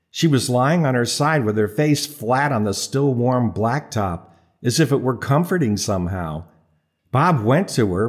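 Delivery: ordinary delivery, nothing marked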